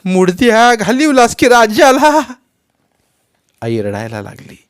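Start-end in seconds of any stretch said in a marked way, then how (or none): 0.0-2.3 wailing scream, much higher and louder; grieving mother's shrieking accusation. A narrator's reading. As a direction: hurl the blame at him while grieving. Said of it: "आई ओरडली".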